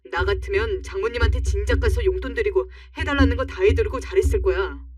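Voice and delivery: loud, furious scolding; yelling husband. Acted. Husband shouts at his wife in a heated fight, loud and furious. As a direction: tear into her for upsetting his mother.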